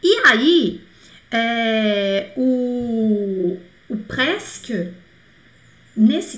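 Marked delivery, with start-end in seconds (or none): none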